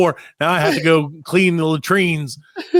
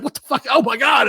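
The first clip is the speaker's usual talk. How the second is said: startled yelp; 9 semitones higher than usual. Retold or imitated. Hit by thrown doubloons.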